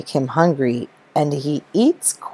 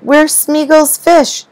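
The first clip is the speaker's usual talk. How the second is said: alarmed cry; 8 semitones higher than usual. Acted. Wife finds the fish shelf empty, alarmed high cry. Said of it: "Then Valerie cried".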